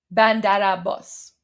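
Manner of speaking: "Bandar Abbas" with a flat "a" in "Bandar" and the stress landing on "bas"; correct Persian pronunciation. Shown as a demonstration. Model pronunciation for viewers, careful Persian style. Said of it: "repeat after me".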